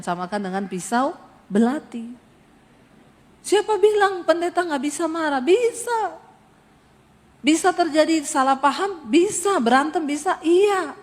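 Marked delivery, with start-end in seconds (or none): 3.4-10.9 preaching at full force. Acted. Rhetorical call and answer.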